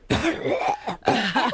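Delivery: a high, hammy hacking cough; mock cough imitating the driver in the hall; imitated cough. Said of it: "like that".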